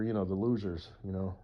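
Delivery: ordinary delivery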